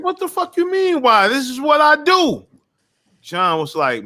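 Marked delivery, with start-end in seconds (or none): 0.0-2.5 much higher, fired-up retort; indignant outburst. Retold or imitated. Retelling his answer to John's why, high and heated. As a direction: snap back like the question is absurd. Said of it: "It's like".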